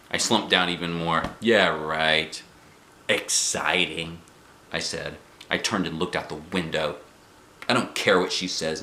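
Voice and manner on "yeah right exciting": slow, scornful drag on the last word; sarcastic grumble. Acted. Junie B mocks Mother's hike advice, sarcastic and slow. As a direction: roll your eyes through it, dripping sarcasm. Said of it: "I grouched".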